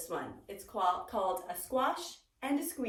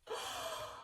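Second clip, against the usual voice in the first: gasp; 10 dB quieter than usual. Acted. Shocked at chaos.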